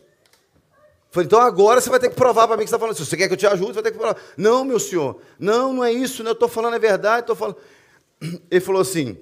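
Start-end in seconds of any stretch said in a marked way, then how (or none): 1.3-4.2 higher, louder demand for proof; confronting challenge. Retold or imitated. Pastor challenging a stranger to prove it, confronting. 4.4-7.6 high-pitched, insistent mock pleading; imitated protest. Accused man insisting to the pastor, imitated pleading protest.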